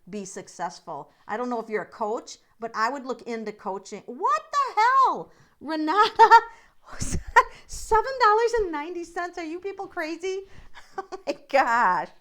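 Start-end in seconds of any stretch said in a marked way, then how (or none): 4.1-10.4 high, loud, astonished squeal; shocked delight. Real, not acted. Host stunned by viewer Renata's donation, squealing in surprise. Reacting to a big Super Chat donation. 10.5-12.1 laughing. Real, not acted. Laughing at the donation.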